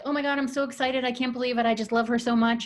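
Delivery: high, run-on gushing; mock overjoyed gush; imitated gushing. Quoting gushing parent about baby.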